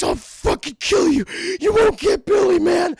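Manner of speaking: high, loud deranged threat; furious screaming. Acted. Mister Cunningham pounding Terry to protect Billy, high furious scream.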